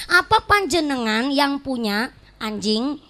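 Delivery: "anjing" split off like an insult; intonasi yang salah. Shown as a demonstration. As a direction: pause after "punya", then put "anjing" on its own. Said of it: "Salah intonasi". (salah intonasi, "anjing" dipisah seperti makian)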